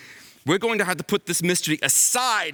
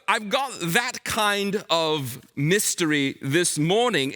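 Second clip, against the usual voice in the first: ordinary talk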